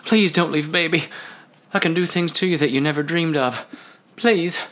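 high, coaxing seductive plea; begging. Acted. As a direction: keep begging in a lifted voice. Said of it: "he begged".

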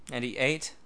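ordinary delivery

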